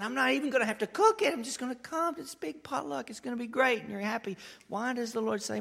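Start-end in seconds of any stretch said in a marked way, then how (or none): 0.0-3.8 much higher, fast and giddy; giddy anticipation. Retold or imitated. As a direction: gush like a kid before a potluck.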